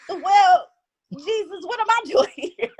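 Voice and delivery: high, laughing disbelief at the news; half-laughing, stunned, asking what am I doing here. Retold or imitated. Teen telling herself about the diagnosis, high laughing disbelief.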